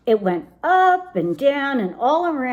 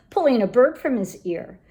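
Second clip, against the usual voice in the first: ordinary delivery, nothing marked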